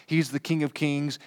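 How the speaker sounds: ordinary talk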